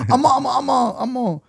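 high-pitched mocking voice. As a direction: play it up in a high voice. Repeating the mock voice.

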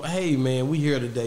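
smooth radio host voice. Shown as a demonstration. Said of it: "I put my radio voice back on".

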